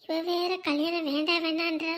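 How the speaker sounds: very soft thinking aloud; worried aside. Acted. Fretting privately that the bride may refuse him, hushed worried aside. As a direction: keep the doubts under your breath.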